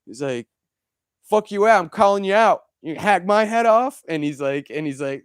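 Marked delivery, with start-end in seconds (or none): 1.2-4.0 high, loud ghost-hunter bravado; mock aggressive challenge. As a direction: shout the ghost down, hammed up. Medium challenging ghosts in basement.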